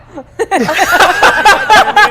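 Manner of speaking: big open belly laugh; loud laughter. Real, not acted. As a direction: burst out laughing loudly. Anchor laughing hard.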